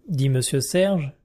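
ordinary delivery, nothing marked

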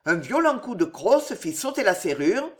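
usual speaking voice

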